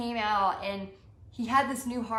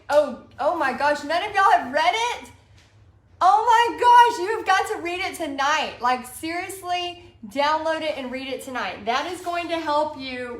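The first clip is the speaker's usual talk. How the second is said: much higher and louder, gushing disbelief; fired up; 4 semitones higher, 7 dB louder than usual. Real, not acted. Urging viewers to read the book, excited shout. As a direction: burst out, can't believe nobody read it. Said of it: "I get very fired up about this stuff".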